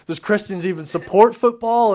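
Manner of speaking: high, loud retold astonishment; hammed-up disbelief, pitch way up; amazed disbelief.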